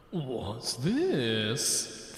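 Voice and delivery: ordinary talk, nothing marked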